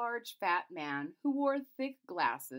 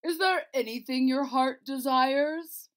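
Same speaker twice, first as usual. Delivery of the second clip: booming king; 6 semitones higher, 9 dB louder than usual. Acted. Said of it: "the king said".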